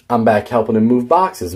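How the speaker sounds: usual speaking voice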